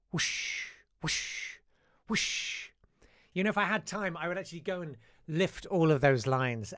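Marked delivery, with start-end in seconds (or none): 0.1-2.6 put-on breathy whispered whooshes in an Irish accent. Irish painter demonstrating a gentle stroke, whispered. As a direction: whisper the brush sound softly, Irish lilt. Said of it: "Gently like a whisper".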